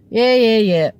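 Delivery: mock a drunk's "yeah, yeah" in a British accent; brisk Pommy-accent brush-off. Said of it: "In his Pommy accent".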